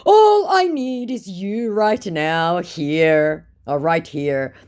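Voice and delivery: drawn-out seductive line; love bombing scammer. Retold or imitated. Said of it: "starts my love bombing scammer".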